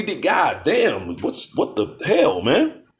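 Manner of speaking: ordinary talk, nothing marked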